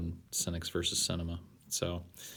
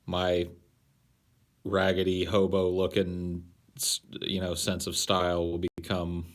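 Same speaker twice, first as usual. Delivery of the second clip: usual speaking voice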